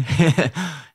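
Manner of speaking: a short real laugh; a quick amused laugh. Real, not acted. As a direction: laugh it off lightly. Laughs at being asked.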